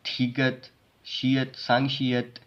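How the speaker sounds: usual speaking voice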